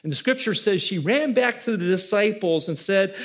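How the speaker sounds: normal talking voice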